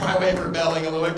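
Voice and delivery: ordinary talk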